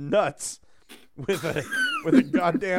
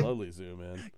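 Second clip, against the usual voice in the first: mock ad voice; 9 semitones lower, 8 dB quieter than usual. Repeats in the ad voice.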